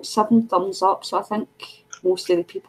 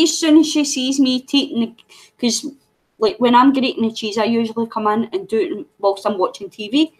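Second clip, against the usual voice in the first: usual speaking voice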